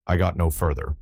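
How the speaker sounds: usual speaking voice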